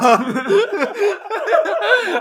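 high, long burst of laughter. Real, not acted. Laughs at own story.

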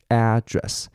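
noun "address" said AD-dress, front syllable drawn out; "address" stressed up front, as AD-dress; stress on first syllable. Shown as a demonstration. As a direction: lean on the AD of "address", let the rest drop. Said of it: "它的重音在add这边".

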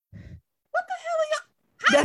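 very high, slow, drawn-out mock outburst; imitating exasperated boyfriend. Friend imitating Corey's reaction, mock exasperated. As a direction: squeal it slowly in mock disbelief.

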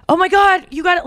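high-pitched imagined exclamation; excited. Retold or imitated. Boyfriend she imagined eager to introduce his friends, excited. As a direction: imitate eager delight. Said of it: "he was very excited for me".

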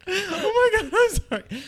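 high, loud, laughing mock panic; embarrassed shrieking sorry; laughing apology. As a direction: squeal the apology through laughter.

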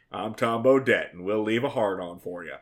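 low, soft folksy ad voice; Tom Bodett voice. Retold or imitated. Friendly motel ad spokesman, low soft folksy voice. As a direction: croon it like an old motel ad. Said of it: "in the same tone of voice as, like, Tom Bodett".

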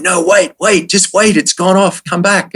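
high, pleading call to come back; mate pleading not to quit after the croc, high and urgent. Retold or imitated. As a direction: excitedly beg him not to leave. Rick pleading with him to stay.

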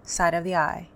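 calm, paced guided tapping with pauses between points; guided tapping script. Acted. Tapping coach to viewers, calm guided script. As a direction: lead the viewer through tapping points and phrases to repeat.

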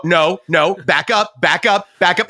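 usual speaking voice

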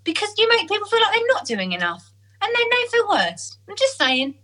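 mock the friend's blunt advice; a hint of a preachy friend; imitating best friend. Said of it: "She goes".

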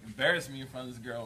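ordinary talk, nothing marked

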